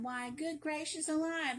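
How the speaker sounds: ordinary delivery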